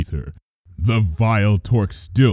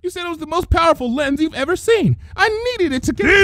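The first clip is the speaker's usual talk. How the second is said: fast, high, panicked excuse; faster than usual. A narrator's reading. Trixie babbling excuses to Luna, fast and panicked. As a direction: rush the excuse out in fright.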